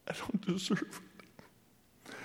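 slow, breathy tearful whisper; a son crying to his dad. Retold or imitated. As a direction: whisper it through tears. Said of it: "And I started crying".

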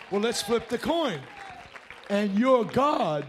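loud, driving sermon voice; fiery preaching. Acted. Preacher thundering at the congregation about agreeing with God, loud. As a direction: hammer the point home to the congregation.

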